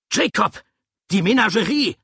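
high, loud alarm call; full-voiced, high-pitched cry of alarm; urgent shout. A narrator's reading. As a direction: yell a warning across the lot. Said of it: "rief er".

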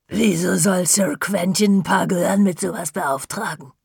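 lightly voiced doubting cleaning lady; suspicious cleaner. Misstrauische Putzfrau fragt nach. (leicht angedeutete misstrauische Putzfrau)

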